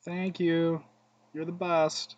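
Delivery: bright, high mock gratitude to the opponent; sarcastic thanks. To the opponent who just burned him, mock sweet thanks. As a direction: thank them sweetly like you mean the opposite. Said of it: "I was supposed to say".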